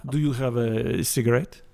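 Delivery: put-on cliché French accent. Guest plays an improv cliché, French accent. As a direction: ask it with a mock French accent. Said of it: "a French anything".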